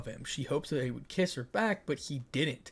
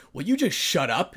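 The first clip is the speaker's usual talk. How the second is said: snapping; 4 semitones higher, 8 dB louder than usual. Acted. Issei snaps at ranting Ria.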